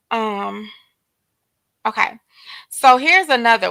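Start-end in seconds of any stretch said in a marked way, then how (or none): none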